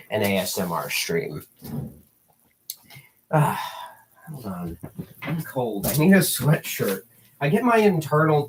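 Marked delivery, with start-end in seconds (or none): none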